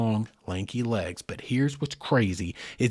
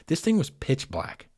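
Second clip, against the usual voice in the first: ordinary delivery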